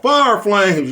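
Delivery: high, loud burst of "Fire flames"; sudden high loud catchphrase; hype shout. Real, not acted. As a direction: fire it out like a cheer. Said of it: "hyping catchphrase".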